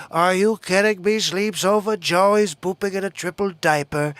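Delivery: put-on presidential impression voice; comic impression of a ranting president; Donald Trump. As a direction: do a mocking politician impression. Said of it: "Bubba says he likes my Trump impression".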